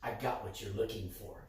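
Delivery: low, hushed confidential offer; quiet, low aside; said into the ear. Retold or imitated. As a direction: drop your voice as if speaking into someone's ear. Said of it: "he says to my ear".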